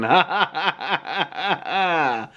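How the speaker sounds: acted staccato villain cackle; evil laugh in separate, falling "ha"s; hammed-up mutant laughter. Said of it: "masked mutant starts laughing".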